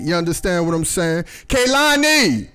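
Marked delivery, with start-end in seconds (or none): 1.5-2.5 long, high-pitched name call. Real, not acted. Summoning Kaylani mid-story, drawn-out call. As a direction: stretch the name out high and slow. Calls Kaylani again, interrupting himself.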